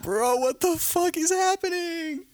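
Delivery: high, slow, drawn-out bewilderment; mock disbelief. Onlooker watching a couple fight, mock disbelief. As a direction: stretch it out in squeaky, incredulous amazement.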